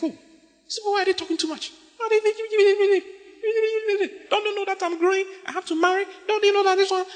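mock an impatient youngster; whining youth. Young church member complaining about marriage talk, high whiny mock.

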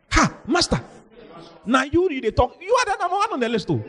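high-pitched, incredulous exclamations; acted shock. Disciples protest to their rich master, shocked to the core. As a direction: gasp it out, then protest in a high voice. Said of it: "They were shocked to the core".

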